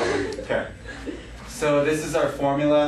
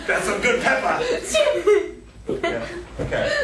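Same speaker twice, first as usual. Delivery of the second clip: laughing. Real, not acted. Teasing about pepper.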